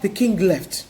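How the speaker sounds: ordinary delivery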